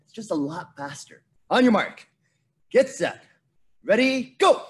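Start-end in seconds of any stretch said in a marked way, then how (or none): 1.5-4.6 loud race-start call, building to GO; raised, punchy starting command; starter's call. Real, not acted. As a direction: call out each step of the start, then fire them off.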